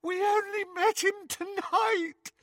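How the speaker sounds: sobbing. Acted. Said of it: "Hermione sobbed".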